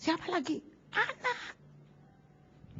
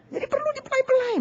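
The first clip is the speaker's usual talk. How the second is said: teasing; 13 semitones higher than usual. Acted. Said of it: "playful aside".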